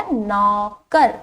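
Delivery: drawn-out teacher model of नौकर; slow, drawn out. Shown as a demonstration. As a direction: stretch नौकर slowly, syllable by syllable. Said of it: "क्या शब्द बन गया?". (धीरे-धीरे खींचकर बोलिए)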